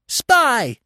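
very high bird shriek; piercing, high-pitched accusation; shrieking jay. A narrator's reading. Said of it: "shrieked the jay".

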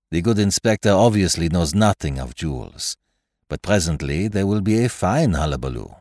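put-on placid French detective, English with French accent. Poirot's dialogue in narration.

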